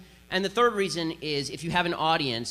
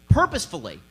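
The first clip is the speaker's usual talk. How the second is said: purposefully punched out louder, falling hard at the end; stress on purposefully; 7 dB louder than usual. Real, not acted. Speaker accusing Democrats of keeping people poor, punching purposefully. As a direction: slam the word purposefully like a verdict.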